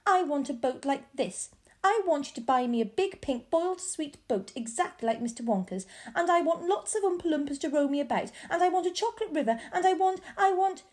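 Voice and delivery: put-on a spoilt girl working up to a tantrum; bratty rising demands; spoilt demanding child. Spoilt Veruca demanding things.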